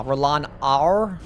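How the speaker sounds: Rolan R with a drawn-out R tacked on the end. Retold or imitated. Streamer repeating chat's complaint, R on the end. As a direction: say Rolan R slowly, ending in a hard R. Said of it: "sounded like it ended with an R".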